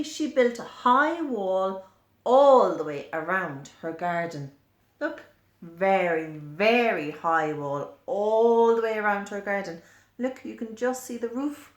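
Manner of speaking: ordinary delivery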